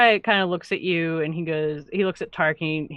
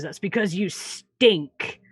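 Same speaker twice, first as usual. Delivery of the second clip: slow, gruff half-orc insult; half-orc man; much slower than usual. Acted. Half-orc woodworker insulting the human, slow and gruff. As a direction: sneer it slowly at the human. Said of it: "comes out of the tent and goes".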